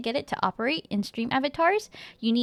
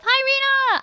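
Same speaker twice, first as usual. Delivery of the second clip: high, loud, delighted squeal; excited greeting; 13 semitones higher, 6 dB louder than usual. Real, not acted. Streamer greets a returning chatter, excitedly. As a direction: squeal hello to a dear friend.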